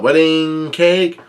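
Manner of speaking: slow, drawn-out cry of "Wedding cake?"; hungry, slow mock yell. A narrator's reading. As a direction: stretch it out like a craving giant. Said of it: "Big Mom yells out".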